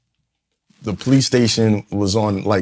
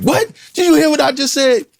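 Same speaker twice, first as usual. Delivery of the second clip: much higher, indignant shout; defensive outburst; 4 dB louder than usual. Retold or imitated. Father shouting back at his teenage son, indignant. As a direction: deflect by snapping back at the son. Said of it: "I'm like".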